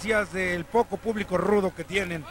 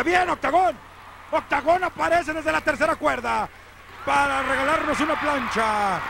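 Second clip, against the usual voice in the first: excited play-by-play, pitched up; wrestling commentator; 4 semitones higher than usual. Real, not acted. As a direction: call the high-flying moves with delight. (narrador de lucha libre emocionado)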